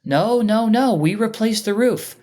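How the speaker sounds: lightly voiced lady refusing the news; elderly homeowner denying the damage; insistent old lady. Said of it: "and she goes".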